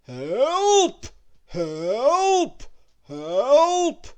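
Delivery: Help! repeated loud, as a rolling echo. Acted. Echo of Mister Noisy around the chamber, loud ringing Help!. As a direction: let each Help! ring back like a chamber echo. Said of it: "deafening echo that rolled and reverberated".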